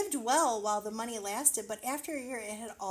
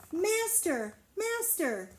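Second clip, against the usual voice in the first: high, urgent calls; harp crying out; 9 semitones higher, 3 dB louder, much slower than usual. Acted. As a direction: cry out for the master in alarm. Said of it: "the harp cried".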